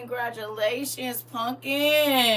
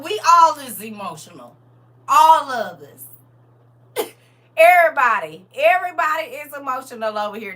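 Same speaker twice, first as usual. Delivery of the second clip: louder, laughing proclamation; loud excited declaring; 9 dB louder than usual. Real, not acted. Host telling the chat everybody is emotional, loud laughing proclamation. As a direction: call it out loud to the whole chat.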